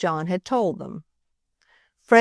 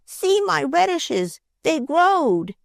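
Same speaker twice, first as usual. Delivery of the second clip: high, slow excited shout; excited child; 9 semitones higher, slower than usual. Acted. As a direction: call out thrilled. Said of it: "screamed Freddie".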